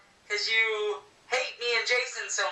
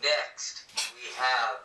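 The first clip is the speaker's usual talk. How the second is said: deep menacing announcer; 12 semitones lower, much slower than usual. Acted. Deep slasher host persona.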